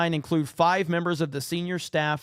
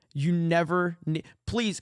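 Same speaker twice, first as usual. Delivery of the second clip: ordinary talk, nothing marked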